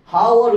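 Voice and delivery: হাওয়ার begun on an audible H; overdone mispronunciation. Shown as a demonstration. Teacher repeating the excessive reading, mispronouncing হাওয়ার with audible H. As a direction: sound the H in হাওয়ার. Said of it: "তাহলে এটা উচ্চারণটা একটু বেশি দেখাচ্ছে না".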